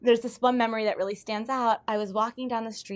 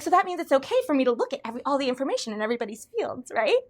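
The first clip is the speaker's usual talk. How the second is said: 1 eager naive question; 5 semitones higher, 5 dB louder, faster than usual. Retold or imitated. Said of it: "So I'm like".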